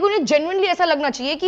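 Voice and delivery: ordinary talk, nothing marked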